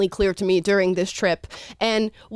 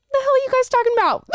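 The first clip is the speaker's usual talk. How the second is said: high-pitched, fast incredulous outburst; squeaky, exaggerated bewilderment; baffled disbelief; 4 dB louder, faster than usual. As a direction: blurt it out in mock disbelief. Acted baffled reaction to claims.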